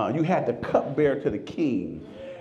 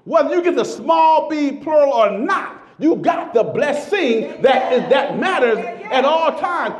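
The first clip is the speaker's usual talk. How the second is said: loud, high, slowed preaching; full-force preaching; 8 semitones higher, 5 dB louder than usual. Acted. Pastor preaching to the congregation, loud and high. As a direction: preach it out to the rafters.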